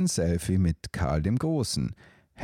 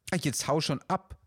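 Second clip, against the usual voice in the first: high, softer dove hissing a brush-off; 8 semitones higher, 3 dB quieter than usual. Acted. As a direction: shoo him off with a hiss. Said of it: "zischte Till dazwischen".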